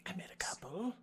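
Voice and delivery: whispered aside. Real, not acted. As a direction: murmur it secretly to yourself. Quiet aside in group.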